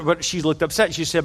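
ordinary delivery

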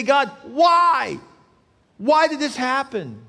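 shouted, high and loud; angry cry at God. Retold or imitated. Preacher voicing a believer shouting his why at God. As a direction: shake your fist and yell why. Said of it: "We shake our fist at God".

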